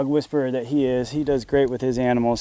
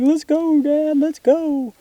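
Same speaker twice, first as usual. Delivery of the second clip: much higher, slow pleading puppy voice; whiny dog voice; 13 semitones higher, slower than usual. Retold or imitated. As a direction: voice the dog begging to go, high and whiny. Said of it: "'cause he is crying. He's like".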